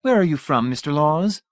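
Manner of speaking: high, simpering society lady; fatuous matron coo. A narrator's reading. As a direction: coo it with empty delight. Mrs Pritchett voiced.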